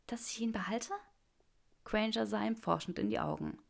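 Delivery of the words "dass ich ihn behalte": soft whispered disbelief; whispered question. A narrator's reading. Granger stunned by the offered ring, whispered. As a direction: barely breathe the question.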